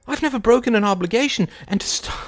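ordinary delivery

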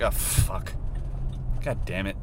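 understated irritated swearing; annoyed. Retold or imitated. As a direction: curse like it's a tiresome chore. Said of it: "I just get annoyed".

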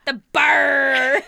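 loud gleeful shout; shouted answer. Acted. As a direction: yell the punchline out. Shouting pirate joke answer.

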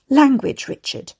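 a mother scolding her son for swearing; scandalized rebuke. A narrator's reading. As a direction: snap at him like a shocked mother. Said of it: "his scandalized mother".

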